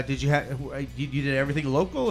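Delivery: ordinary talk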